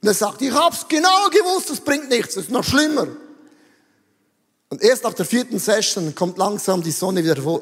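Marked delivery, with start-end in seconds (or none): 0.4-3.1 high-pitched mock indignation; acted husband's high-voiced protest; exasperated complaint. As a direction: whine that you knew it all along. Husband complains after first therapy session.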